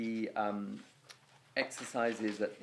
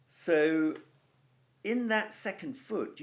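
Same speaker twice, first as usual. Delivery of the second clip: normal talking voice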